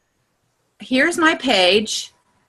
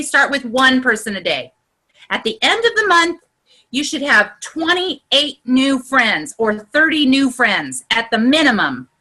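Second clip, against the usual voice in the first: ordinary delivery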